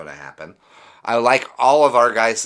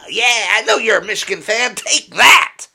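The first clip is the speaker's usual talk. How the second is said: much higher and louder, taunting; gloating rival fan; 11 semitones higher, 8 dB louder than usual. Retold or imitated. Mimicking a rival fan taunting a Michigan fan, shouting. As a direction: jeer like an obnoxious fan scoring a point.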